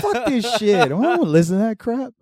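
loud, high mock indignation; scornful outburst. Refusing to listen to that crap, loud high scorn.

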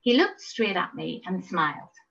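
plain reading voice

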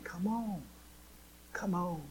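soft, high, gentle call to come home; tender invitation. Acted. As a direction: coax them back softly, like a loving father. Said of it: "And God is saying".

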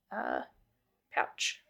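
ordinary talk, nothing marked

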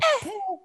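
high, tiny squeaked puff; tiny fire puff. Pretend. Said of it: "they're just like".

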